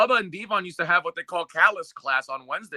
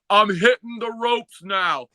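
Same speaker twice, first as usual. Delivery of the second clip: slow, loud, higher announcing shout; mock shout; 5 semitones higher, 4 dB louder, slower than usual. [name] announcing loudly.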